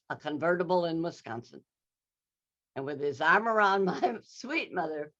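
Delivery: usual speaking voice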